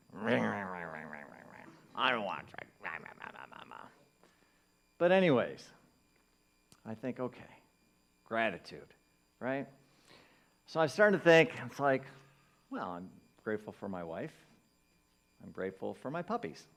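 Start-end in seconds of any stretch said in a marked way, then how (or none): 0.1-3.9 put-on low, childish griping noises; hammed-up reluctant grousing, sinking in pitch; mock grumbling. Grumbles back at the nudge. 12.7-16.6 half-hearted gratitude. Acted. Half-hearted first try at gratitude.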